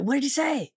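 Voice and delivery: confused voice of the Klansmen; puzzled Klansmen. Retold or imitated.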